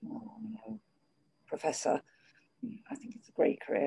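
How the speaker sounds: soft, hushed and meek, voice dropping to a whisper; nervous student hushing his reply to the drunk professor; timid near-whisper. Retold or imitated. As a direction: answer meekly as if afraid to provoke him.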